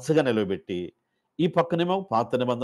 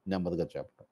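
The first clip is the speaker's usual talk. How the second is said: much softer, almost hushed; soft demo; 6 semitones lower, 10 dB quieter, faster than usual. Shown as a demonstration. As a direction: drop the voice right down to show quiet reciting. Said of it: "అంటే గట్టిగా అరవటం". (పిల్లలకి చూపిస్తూ మెల్లగా, నెమ్మదిగా)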